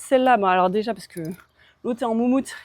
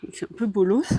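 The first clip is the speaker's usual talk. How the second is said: quick whispered remark; whispered aside; 3 dB louder than usual. Real, not acted. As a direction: whisper it under your breath. Whispered aside while grooming.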